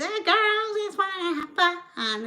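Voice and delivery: high nasal singsong mimic. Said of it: "she's got such a nasally voice".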